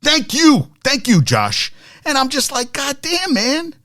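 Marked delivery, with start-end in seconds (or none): 2.7-3.8 disbelief. Retold or imitated. Host reacts to outraged Eagles fans.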